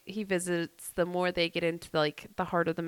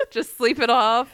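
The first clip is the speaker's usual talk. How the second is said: louder, higher, through real laughter; laughing; 6 semitones higher, 7 dB louder than usual. Real, not acted. Host joins co-host's goody powder joke, laughing. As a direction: let the laugh carry the words.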